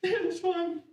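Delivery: 高而细的假意附和; meek high yes. Retold or imitated. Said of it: "I'm just like".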